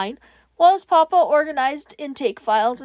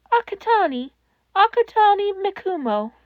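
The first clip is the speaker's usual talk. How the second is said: put-on timid child; 6 semitones higher, 3 dB louder than usual. A narrator's reading. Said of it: "child caller gives name".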